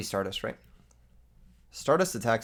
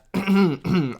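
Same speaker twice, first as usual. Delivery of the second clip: loud throat clear; 7 semitones higher, 6 dB louder than usual. Shown as a demonstration. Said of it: "Okay, hold up".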